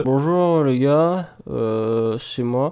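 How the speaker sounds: slow, low sick greeting. Acted. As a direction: greet them feeling ill. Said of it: "je parlais un peu".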